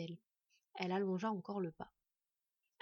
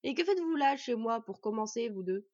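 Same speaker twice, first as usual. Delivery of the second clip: cross demand; 7 dB louder than usual. Acted. Dwarf to two visitors in his house, loud and cross. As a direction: demand an explanation from intruders.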